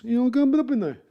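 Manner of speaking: a quick hammed-up Jersey wiseguy line in a New Jersey accent; Sopranos Jersey accent. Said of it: "I gotta go do my Sopranos".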